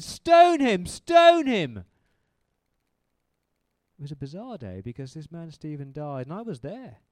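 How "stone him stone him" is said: shouted much higher and louder, twice; furious crowd chant, full voice; mob shout. Retold or imitated. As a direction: yell it like a mob baying for blood. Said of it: "and so we shouted".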